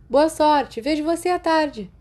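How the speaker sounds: lightly voiced Sirius, offhand and kind; Sirius through the door. Sirius calling to Remus through the bathroom door, friendly.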